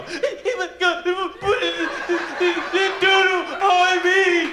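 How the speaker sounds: high, very slow wailing shout; crying girl. Retold or imitated. The girl tells on the boy, high slow wailing shout. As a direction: wail the tattle out.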